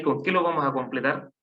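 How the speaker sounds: usual speaking voice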